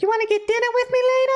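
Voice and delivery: put-on quick, squeaky-high ditzy girl voice; showgirl asking him out. Showgirl asks the man in the tank to dinner, high falsetto. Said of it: "so she's asking him out".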